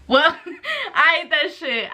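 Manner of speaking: real amused laugh in the words. Real, not acted. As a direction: own up while cracking up. Laughs while owning up.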